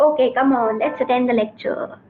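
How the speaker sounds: usual speaking voice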